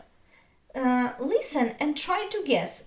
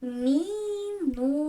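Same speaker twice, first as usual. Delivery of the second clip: "minuta" drawn out as three separate long beats; split into syllables; 2 semitones higher, slower than usual. Shown as a demonstration. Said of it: "So we can divide".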